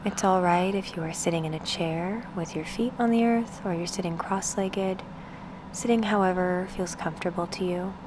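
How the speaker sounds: unhurried meditation guide, with long pauses between phrases; guided meditation. Acted. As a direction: ease the listener into stillness, spacing out each instruction.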